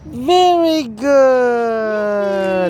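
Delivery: very long drawn-out high praise. Real, not acted. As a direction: stretch it into a long falling cheer. Cheering toddler.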